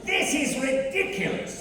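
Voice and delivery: high indignant wizard; whiny evil spellcaster. Acted. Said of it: "says the spellcaster".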